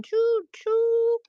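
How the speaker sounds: high loud choo choo; train whistle call. Acted.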